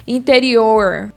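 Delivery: "interior" with a drawn-out retroflex final R; slow caipira "interior"; São Paulo countryside accent. Shown as a demonstration. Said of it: "O pessoal do interior, eles falam".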